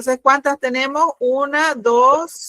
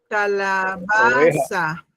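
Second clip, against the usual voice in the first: drawn out syllable by syllable; slow dictation; 3 semitones lower, much slower than usual. Shown as a demonstration. Teacher repeating a student's word for the class, slow and drawn out. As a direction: stretch each syllable for the class to follow.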